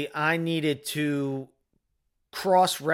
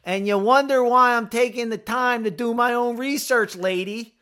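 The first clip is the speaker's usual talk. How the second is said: high, loud sarcastic retort; indignant shout; 11 semitones higher, 6 dB louder than usual. Pretend. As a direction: throw it back at her, fed up. Imagined retort to the nurse.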